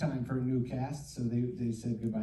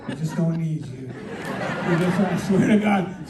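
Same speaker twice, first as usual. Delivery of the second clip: laughing boss quote; 2 semitones higher, 8 dB louder, slower than usual. Retold or imitated.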